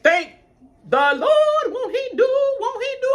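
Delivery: high, loud joyful praise; praising the Lord. Real, not acted. Relieved after no parking ticket, high joyful praise. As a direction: shout thanks up to heaven.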